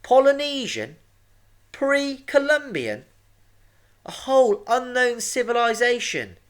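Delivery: very high, loud mum voice; high-pitched, thrilled exclaiming; excited declaration. A narrator's reading. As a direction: declare each guess loudly, pitched right up. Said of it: "declared Oliver's mum".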